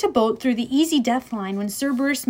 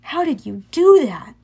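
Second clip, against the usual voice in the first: lightly raised, louder question; amazed; 4 semitones higher, 5 dB louder than usual. A narrator's reading. Percy asks Annabeth about taming Cerberus, amazed. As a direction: ask with clear amazement.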